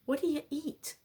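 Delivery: normal talking voice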